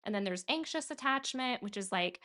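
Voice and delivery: ordinary talk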